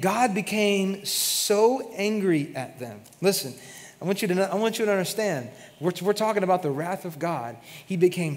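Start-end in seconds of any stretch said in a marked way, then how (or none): none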